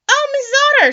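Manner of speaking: high, loud smitten bee; eager storybook bee calling; Mister Bee. A narrator's reading. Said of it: "And he says".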